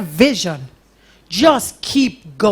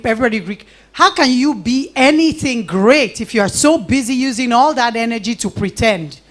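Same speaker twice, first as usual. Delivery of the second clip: usual speaking voice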